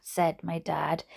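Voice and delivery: ordinary delivery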